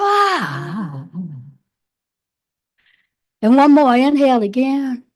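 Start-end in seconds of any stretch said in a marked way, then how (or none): 0.0-1.1 deep low exhale; dramatic sigh. Shown as a demonstration. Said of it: "Open the mouth and just go".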